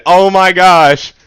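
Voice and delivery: slow, loud, drawn-out oh my gosh; slow, loud, exasperated sigh of words; exasperated groan. Real, not acted. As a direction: stretch it out, fed up and worn out. Exasperated after long reading.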